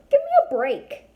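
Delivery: high, loud exasperated cry. Acted. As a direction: throw up your hands, totally fed up. Exasperated outburst at brother.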